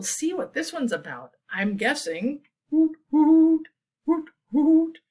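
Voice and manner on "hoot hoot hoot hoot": high, loud owl hooting. Acted. Reader showing children an owl's call, high hooting. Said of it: "Is that what an owl says?".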